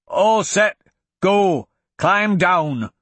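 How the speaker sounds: high, loud gruff dwarf call; dwarf shouting back. A narrator's reading. Dwarf shouting back to the others, high and loud. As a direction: bellow up the order to climb down. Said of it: "the dwarf shouted back".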